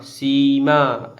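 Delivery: सीमा with ई and आ drawn out slowly; teacher stretching सीमा; long vowels. Shown as a demonstration. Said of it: "ज़्यादा आवाज़ में बोला जाएगा".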